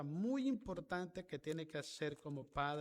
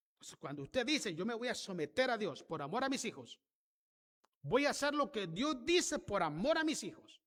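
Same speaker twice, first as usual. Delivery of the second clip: loud, rising pulpit delivery; fiery sermon climax, louder and higher; full-force preaching; 5 semitones higher, 5 dB louder than usual. Acted. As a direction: drive the message home to the congregation.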